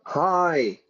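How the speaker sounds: lightly acted excuse to the boss; mock late excuse. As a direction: play at calling in late, voice lifted. Mock phone excuse for being late.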